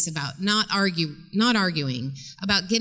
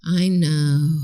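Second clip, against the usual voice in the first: low, loud, drawn-out breath out; empty your lungs on the words; long exhaled sigh; 4 semitones lower, 5 dB louder than usual. Shown as a demonstration. Counselor teaching parents to resist talking, sighing it out. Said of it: "let all the air out of your lungs".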